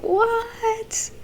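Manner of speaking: long, very high drawn-out what; a gasping mock what; stunned audience. Viewers react to the twist in shock. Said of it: "we're all like".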